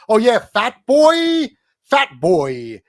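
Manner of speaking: very loud, drawn-out exaggerated taunt; hammed-up imitation of a weirdo jeering, slow and booming; mocking name-caller. Streamer mimicking his heckler's odd way of talking, mocking. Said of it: "I never heard anybody talk like that".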